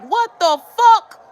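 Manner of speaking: slow, high-pitched retold reaction; spooked disbelief. Hearing a cat inside the room, slow high disbelief. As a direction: stretch it out high in startled disbelief.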